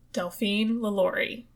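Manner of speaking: normal talking voice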